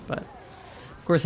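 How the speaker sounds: mid-yawn words; breathy yawn sound. Real, not acted. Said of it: "yawning uncontrollably".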